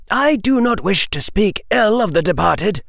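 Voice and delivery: high-pitched, stern elder voice; old witch. A narrator's reading. Old witch addressing Harry about Minerva's opinion, high stern voice. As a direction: speak as a formidable witch, pitch well up. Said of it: "the old witch said".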